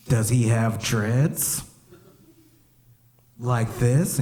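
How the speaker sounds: very slow drawn-out question; mock coy. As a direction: stretch it out playing innocent. Coy self playing dumb.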